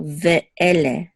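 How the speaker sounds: Ve'ele with a break after Ve; distinct syllables. Shown as a demonstration. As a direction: keep Ve apart from ele in Ve'ele. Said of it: "We don't slur it together".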